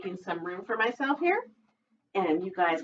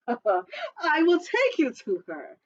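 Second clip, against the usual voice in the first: sly fox; 9 semitones higher, much slower than usual. Acted. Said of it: "said Foxy Loxy".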